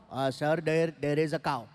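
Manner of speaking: put-on hesitant Indian-accented driver explaining to his passenger. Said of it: "I asked the driver".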